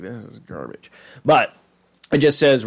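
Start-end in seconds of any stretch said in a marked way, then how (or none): 0.0-0.9 low, very soft aside; muttered dismissal. Pretend. Imagined listener gives up on the podcast, in a low mutter. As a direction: mutter it under your breath.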